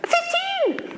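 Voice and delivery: very high, shocked "Fifteen!"; outraged exclamation. Real, not acted. Revealing the cookie's servings to the audience, outraged. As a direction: burst out in disbelief.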